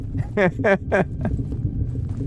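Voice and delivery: airy unvoiced laughter; high breathy giggle. Real, not acted. Still laughing at chat, breathy giggle.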